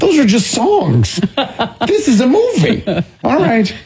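high-pitched, laughing objection; exasperated protest. Real, not acted. Mock protest at host's joke.

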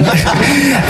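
short high burst of laughter; real laugh. Real, not acted. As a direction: laugh it out, caught up in the memory. Player laughing recalling goal celebration.

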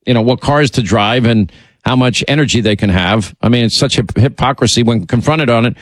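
ordinary talk, nothing marked

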